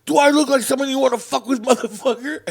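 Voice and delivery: loud, high-pitched angry challenge; retold menacing dad. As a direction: imitate dad yelling a threat. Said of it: "my dad just goes like this".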